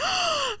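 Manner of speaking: high shocked gasp; gasping as the tarp knocks the cake over; sharp gasp. Retold or imitated. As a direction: suck in air in horror. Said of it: "I'm like".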